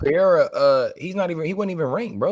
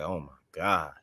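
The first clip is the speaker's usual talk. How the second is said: stunned quiet; 12 semitones lower, 11 dB quieter than usual. Retold or imitated. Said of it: "I was like".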